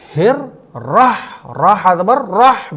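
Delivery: रह with full rolled r and strong breathy h; correct heavy ra. Shown as a demonstration. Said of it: "आपको क्या पढ़ना है?". (रह में रा मोटा, बड़ी हा)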